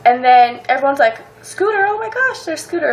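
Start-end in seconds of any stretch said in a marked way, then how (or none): 1.4-2.9 high, thrilled squeal at spotting him; starstruck squeal. Retold or imitated. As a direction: gasp his name like a star just appeared. Fans spotting Scooter nearby.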